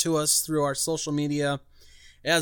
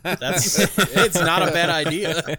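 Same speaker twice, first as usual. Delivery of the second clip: laughing; 4 semitones higher, 7 dB louder, slower than usual. Real, not acted. Laughing at segment suggestion.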